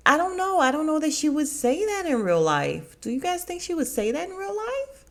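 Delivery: can't believe she'd really say that; incredulous. Real, not acted. Vlogger doubting the show to viewers, high incredulous.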